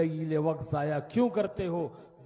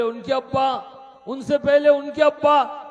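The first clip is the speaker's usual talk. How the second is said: higher and louder, building; king answers the beggar, louder and higher; loud insistence; 7 semitones higher, 10 dB louder than usual. Acted. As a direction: press each answer harder.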